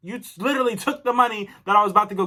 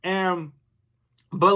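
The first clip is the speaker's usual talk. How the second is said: usual speaking voice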